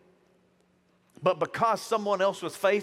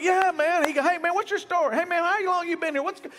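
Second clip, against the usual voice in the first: much higher, louder happy cry; fired-up joy; 12 semitones higher, 4 dB louder than usual. Retold or imitated. Preacher voicing a cheerful stranger in Walmart, high and loud. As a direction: burst out like the happiest man in line. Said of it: "he is just fired up".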